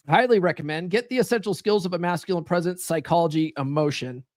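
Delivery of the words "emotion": normal talking voice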